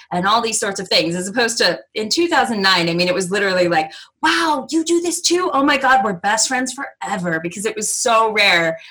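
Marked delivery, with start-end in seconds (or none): 4.2-7.4 amazed new best friend; excited gushing. Retold or imitated. Nomads meeting, quoted.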